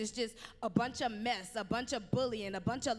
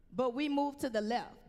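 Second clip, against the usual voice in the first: ordinary talk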